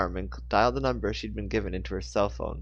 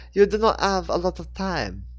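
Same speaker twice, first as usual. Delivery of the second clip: higher, louder French voice; urgent French swordswoman; French accent; 8 semitones higher, 6 dB louder than usual. A narrator's reading. French swordswoman warns Rachel in a French accent. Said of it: "thick French accent".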